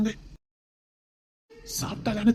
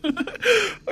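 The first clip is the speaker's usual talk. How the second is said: joyful laugh; 10 semitones higher than usual. Acted. Husband learns he'll be father.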